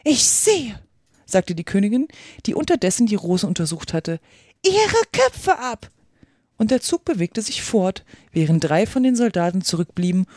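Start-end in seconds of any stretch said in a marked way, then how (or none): 0.0-0.8 high-pitched queen cutting in; imperious queen. A narrator's reading. Königin unterbricht den Gärtner. 4.6-5.9 high, drawn-out execution command; queen's furious order. A narrator's reading. Königin befiehlt die Hinrichtung.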